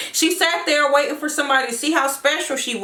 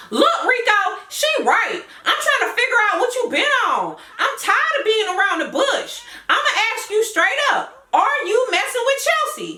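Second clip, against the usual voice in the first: put-on high, accusing girlfriend; confronting girlfriend voice; 9 semitones higher than usual. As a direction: grill him like a suspicious girlfriend. Girlfriend confronting boyfriend about cheating.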